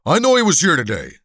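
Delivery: loud, high-pitched menacing thug; threatening shout. Acted. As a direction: shout the threat to intimidate the bartender. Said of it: "the hulk shouted".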